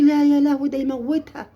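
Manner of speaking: high mock wail of a frightened mom; lightly voiced panicked mother; a hint of a mother's alarm. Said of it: "هتقولي".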